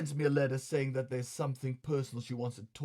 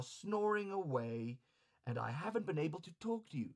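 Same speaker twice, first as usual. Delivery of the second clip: higher, plaintive courtesan; high soft woman's voice; 4 semitones higher, 6 dB quieter than usual. Acted. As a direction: plead softly, a bit hurt. Osame explaining, plaintive.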